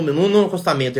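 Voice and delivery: ordinary talk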